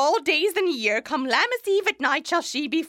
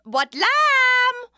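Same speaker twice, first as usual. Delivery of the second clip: calling out; 9 semitones higher, 4 dB louder than usual. Acted. Nurse calling for Juliet.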